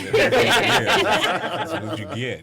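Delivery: very high, loud and slow; customer service voice. Acted. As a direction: stretch it out like a phone agent. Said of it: "my customer service voice".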